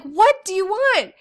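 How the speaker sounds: high, loud, slow annoyed question; exasperated. Retold or imitated. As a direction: ask it fed up. Exasperated mom.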